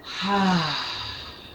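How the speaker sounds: audible sigh on the exhale; release sigh. Shown as a demonstration. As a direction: let the breath fall out with a sigh. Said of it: "Take a breath and go".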